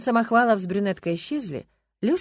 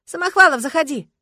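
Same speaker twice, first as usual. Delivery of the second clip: high, loud call to the hallway; 8 semitones higher, 10 dB louder than usual. Acted. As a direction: call him in from across the flat. Calling guest in from door.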